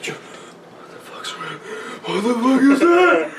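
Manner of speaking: slow, high, breathless disbelief; mocking confused soldier. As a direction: drag it out like a baffled grunt.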